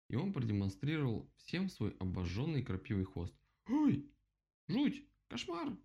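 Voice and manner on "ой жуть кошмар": very high little squeals; horrified. A narrator's reading. Animals see the burnt tail, horrified squeals. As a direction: squeal each cry of horror separately. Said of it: "Хором откликнулись остальные, в ужасе".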